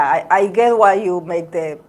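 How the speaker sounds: ordinary delivery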